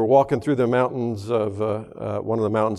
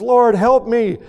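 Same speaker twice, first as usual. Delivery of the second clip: higher, louder cry for help; 9 semitones higher, 5 dB louder, slower than usual. Retold or imitated. Atheist chased by a bear cries out for help. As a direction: yell it up to heaven in panic. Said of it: "he cries out to God".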